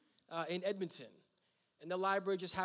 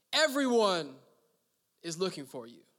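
exclaiming; 7 dB louder than usual. Acted. Disciples finding Jesus, excited exclaiming. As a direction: burst out with the news.

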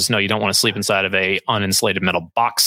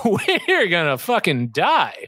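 high and slow, laughing through the words; giggling resignation, pitched up and stretched; laughing doom line; 9 semitones higher, much slower than usual. Retold or imitated. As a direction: drag out a laughing prediction of doom. Leader's grim punchline, retold laughing.

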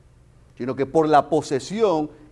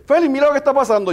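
urgent call; 11 semitones higher, 9 dB louder, much faster than usual. Retold or imitated. Wife calls out at the scene.

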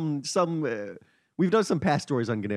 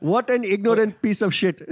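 reading a quote in a mock Indian accent, higher and louder; 6 semitones higher, 6 dB louder than usual. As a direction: put on a hammed-up Indian accent for the tweet. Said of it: "accent is mine".

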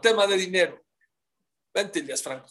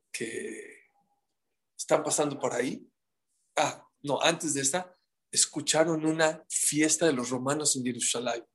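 usual speaking voice

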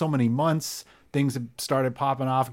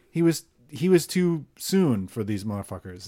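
usual speaking voice

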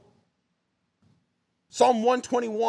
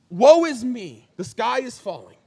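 mock despair; 2 semitones higher, 7 dB louder, slower than usual. Mocking complaining Christians.

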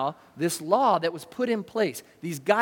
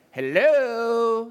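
impatient hello; 5 semitones higher, 5 dB louder than usual. Retold or imitated. Accusers impatient with silent Jesus.

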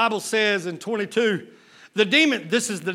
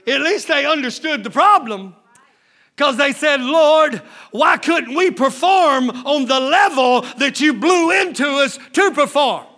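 loud, higher, shouting sermon; full-force preaching; 3 semitones higher, 5 dB louder than usual. Real, not acted. Preacher shouting to his church, full force. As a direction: drive it home to the congregation.

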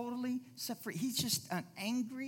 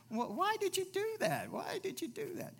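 exasperated; 10 semitones higher than usual. Retold or imitated. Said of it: "You think".

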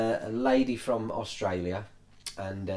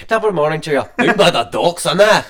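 hammed-up loud accent, laughing; loud mock Irish-Scouse; 7 semitones higher, 13 dB louder, much faster than usual. Friends mocking the vocal coach, loud and laughing. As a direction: bellow a stage Irish-Scouse mashup. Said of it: "It's a Scouse accent".

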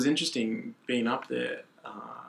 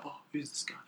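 hushed, much softer aside; whispering bystander; 4 semitones higher, 11 dB quieter than usual. Retold or imitated. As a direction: murmur it like a curious onlooker. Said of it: "you hear them whispering".

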